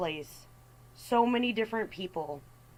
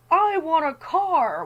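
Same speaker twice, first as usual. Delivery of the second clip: much higher and louder, slow whiny demand; louder, drawn-out mock tantrum for a car; demanding teen; 9 semitones higher, 9 dB louder, slower than usual. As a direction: whine it out like a spoiled teen.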